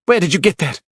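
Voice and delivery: high, loud snarl. A narrator's reading. Widow snarling at Archer over the papers. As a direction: bite the words out. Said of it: "she snarled".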